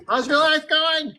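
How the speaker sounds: high, slow goofy greeting; playful drawn-out high voice; silly put-on voice. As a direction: stretch it out in a high comic voice. Silly greeting bit, others laugh.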